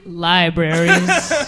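very slow, low, sultry libraries; sultry mock libraries. Mocking James's answer, slow sultry purr. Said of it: "you just sexualized libraries".

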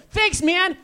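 high, bright-sounding chirpy thanks; perky high thank-you; new high voice. Retold or imitated. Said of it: "That's my voice now".

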